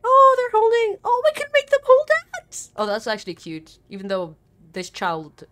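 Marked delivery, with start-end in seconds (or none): 0.0-2.7 high, loud excited squeal; delighted by characters holding hands. Real, not acted. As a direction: gush over something cute. Delighted discovery.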